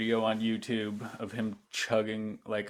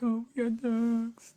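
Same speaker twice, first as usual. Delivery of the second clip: high, slow whiny victim voice; sarcastic high pity-me voice; mock victim; 13 semitones higher than usual. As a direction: whine it like a fake victim. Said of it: "They're like".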